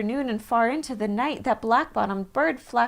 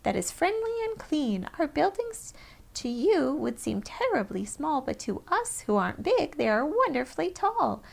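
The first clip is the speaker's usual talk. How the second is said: higher little voice calling up from a speck; small town mayor, higher pitched and proud; tiny mayor calling; 6 semitones higher than usual. A narrator's reading. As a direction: call up from far below, tiny and proud. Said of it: "called the voice".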